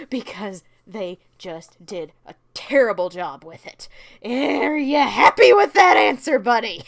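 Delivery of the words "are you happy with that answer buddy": louder teasing jab at the asker; playful loud taunt; mock challenge. Real, not acted. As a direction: needle the viewer playfully.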